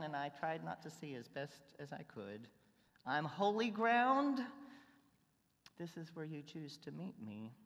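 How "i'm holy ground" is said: slow, louder, higher proclamation; mock grand claim. Believer echoing God's words, slow and louder. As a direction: say it slow with wry disbelief. Wry self-declaration.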